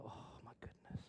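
unvoiced whisper; whispered shock at the table; whispered dismay. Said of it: "We're just like".

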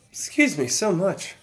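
hiccuping between words; talking through sudden hiccups. Real, not acted. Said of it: "I got the hiccups all of a sudden".

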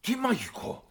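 high, breathless eager question; high-pitched excited royal question; eager king. Acted. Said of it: "ρώτησε με λαχτάρα ο βασιλιάς".

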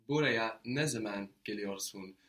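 ordinary delivery

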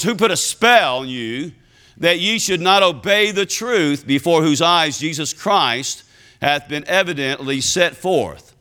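ordinary talk

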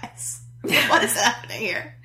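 incredulous laugh; laughing disbelief. Real, not acted.

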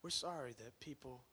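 soft, hushed prayer. Real, not acted. Preacher leading the church to pray to the Spirit, hushed prayer. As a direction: pray quietly and sincerely.

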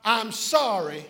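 measured declaration, pausing after the apology; great conviction. Shown as a demonstration. Pastor leading the church in a declaration, with great conviction. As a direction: say it with conviction for others to repeat. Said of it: "say these words with me with great conviction".

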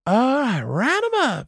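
very high, drawn-out comic catchphrase; Canadian Mountie impression. Mountie in sketch repeats his catchphrase, very high silly voice. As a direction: send each phrase soaring up high. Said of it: "That's all he kept saying".

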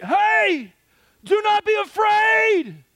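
high shout over the storm; shouted version. Shown as a demonstration. Jesus calls over the storm from far off, a high shout. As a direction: call it across the wind at full voice. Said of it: "Or if it was like".

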